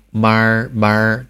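妈儿 with the tongue curled back; 妈儿 with a curled-back r, the wrong way; wrong erhua. Shown as a demonstration. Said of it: "这样读你肯定是错的". (错误的卷舌读法)

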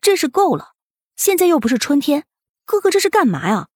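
high, a touch of indignant yell; indignant girl scolding. Acted. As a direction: scold him, mildly indignant. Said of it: "愤愤地吼道".

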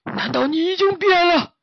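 high, loud alarmed cry; panicked. Acted. Master shocked the pig may have changed, high and panicked. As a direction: panic at the news. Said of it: "慌张地看着小猪说".

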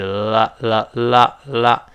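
"啦" repeated with a forward-tongue L; L sound demonstration. Shown as a demonstration. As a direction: separate "啦" syllables showing the L. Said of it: "L还是舌尖往前指".

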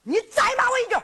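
high, indignant dare; angry challenge. Acted. Acted quarrel, boy dares girl. (高声怒斥挑衅)

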